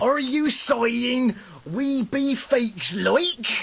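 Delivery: slow, hammed-up country accent; awful yokel accent. Disguised wizard challenging the boy, phoney yokel accent. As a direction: question in a fake bumpkin accent. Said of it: "You have awful accents".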